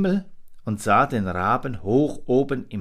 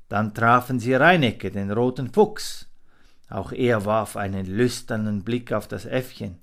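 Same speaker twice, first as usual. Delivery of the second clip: ordinary delivery, nothing marked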